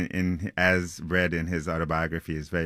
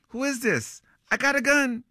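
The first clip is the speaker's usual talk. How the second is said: very high, panicked challenge; acted high-voiced alarm; alarmed threat. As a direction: yell a high-pitched warning at an intruder. Roommate threatens the unseen intruder.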